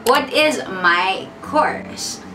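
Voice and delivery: ordinary talk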